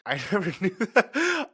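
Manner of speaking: surprised laugh, high and loud; delighted laughing surprise. Real, not acted. Surprised by guest's story.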